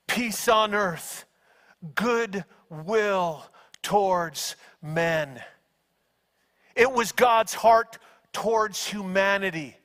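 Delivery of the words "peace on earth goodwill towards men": slow, low, soft benediction; hushed slow heavenly peace; tender blessing. Retold or imitated. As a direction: bless them gently, drawing it out.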